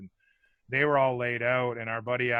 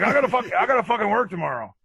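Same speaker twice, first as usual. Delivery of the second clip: high, loud grumbling dad; annoyed dad; 9 semitones higher, 6 dB louder than usual. Retold or imitated. Dad complaining to sons about work tomorrow, high loud grumble. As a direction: gripe like a tired working father. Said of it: "It's like".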